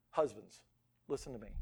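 low, soft and earnest; solemn appeal. Real, not acted. Solemn direct appeal to husbands.

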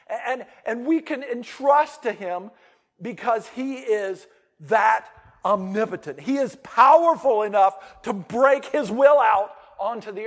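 loud, high-pitched preaching build. Real, not acted. Pastor preaching God's power to the congregation, loud and high. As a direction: proclaim it to the whole church.

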